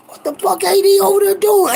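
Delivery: high, stunned outcry; shocked yell. Retold or imitated. Kid watching his brother beat the coach, high shocked cry. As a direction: voice jumps up in disbelief.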